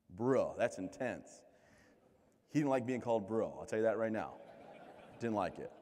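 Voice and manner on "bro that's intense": laid-back bro. Retold or imitated. Slow laid-back reply to recruiter.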